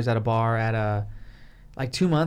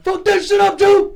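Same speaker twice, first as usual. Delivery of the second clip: much higher, much louder cheer; cheering yell; 10 dB louder than usual. Retold or imitated. Cheering Julie's home run, full yell from the stands. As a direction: scream it from the stands. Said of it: "and we're like".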